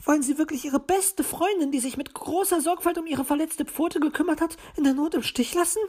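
high, wounded magpie voice; hurt, reproachful bird lady; sad. A narrator's reading. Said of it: "fragte sie traurig".

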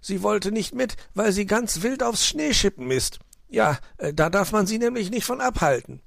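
high-pitched, exasperated explaining; flustered fox making excuses; defensive fox uncle. Acted. Fox talks his way out.

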